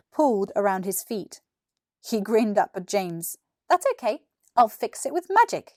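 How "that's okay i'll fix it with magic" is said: put-on higher, confident kid voice; cheerful boy. Billy grinning up at James, cheerful boy. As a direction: reassure your friend with a grin.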